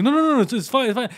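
high, loud flustered no-no-no; nervous refusal. Retold or imitated. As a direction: wave off the offer in a panic. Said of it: "And like".

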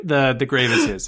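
usual speaking voice

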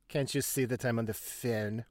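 mock French accent, mildly indignant; imitating Clouseau's French accent. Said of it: "And Clouseau's like".

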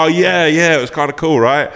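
playing it cool after a knockout; dazed teenager. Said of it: "and dizzy looking round".

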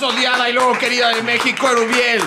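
loud, high ringmaster introduction; big game-show introduction, projected; hype announcer. Pretend. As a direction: present a star to a cheering crowd. Mock introduction of the finalists.

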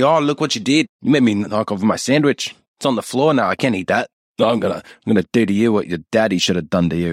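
mock Australian stepfather scolding; attempted Australian. Stepfather blaming stepdaughter for the dropped sandwich, mock Australian. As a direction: scold in a put-on Australian accent. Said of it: "I'm trying to do Australian".

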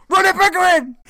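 very high, very loud scream of the name; full-blast mock outrage; hammed-up furious yell. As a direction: imitate a man screaming his own name. Third take, climax of escalating shouts.

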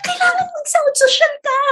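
a daughter coaching her mom, whining; child's whiny plea. Retold or imitated. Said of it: "sabi niya". (anak na nangungulit sa nanay, matinis)

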